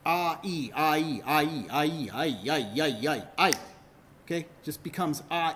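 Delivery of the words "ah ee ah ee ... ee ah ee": "ah, ee" chanted over and over until it blurs; two vowels melting into one glide; repeated vowels running together. Shown as a demonstration. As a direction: keep cycling "ah, ee" ever closer together. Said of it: "if you keep saying it quickly".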